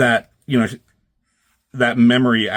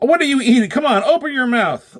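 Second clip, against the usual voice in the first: higher and louder scolding; scolding dog owner; 13 semitones higher, 5 dB louder than usual. Acted.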